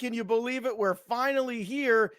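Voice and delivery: high, upbeat host announcing week one; hype intro. Acted. Host opening the show to everybody, high and hyped. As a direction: pump up the audience for kickoff.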